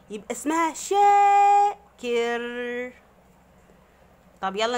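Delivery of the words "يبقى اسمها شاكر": the vowel in شاكر held long and shouted high; شاكر with a long, loud, high first vowel. Shown as a demonstration. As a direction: stretch the شا of شاكر loudly. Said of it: "يبقى ليها صوت عالي قوي".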